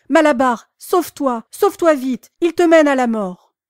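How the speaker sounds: loud high desperate cry; voice of catastrophe. A narrator's reading. Mare crying to her friend in the van, loud and desperate. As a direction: scream his name in despair. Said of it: "d'une voix de catastrophe".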